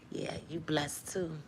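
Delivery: soft, slow sneering whisper; mock sly whisper. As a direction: whisper it like a mocking tempter. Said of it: "the enemy was like".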